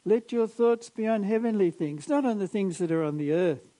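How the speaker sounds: loud, emphatic pulpit preaching; fervent preaching. Acted. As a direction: preach it out with fire. Preaching at full force.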